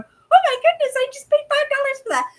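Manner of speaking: hammed-up delighted squeak; gleeful disbelief. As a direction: crow over the profit in a put-on voice. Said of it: "I'm going".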